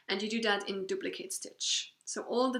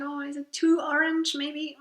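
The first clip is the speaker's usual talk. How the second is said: higher, louder mock worry; doubtful inner voice; 4 semitones higher, 6 dB louder than usual. Recalling her doubts about the yarn colour, in a higher, louder mock-worried voice. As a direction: voice the doubt as a fretful little question.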